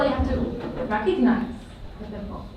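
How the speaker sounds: ordinary delivery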